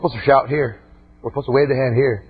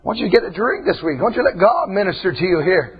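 ordinary talk, nothing marked

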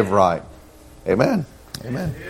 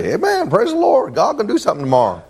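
high-pitched, hurried cry of praise; excited praise; 10 semitones higher than usual. Acted. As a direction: rejoice as a hungry crowd would, voice leaping up. Imagined starving people rejoicing at promise.

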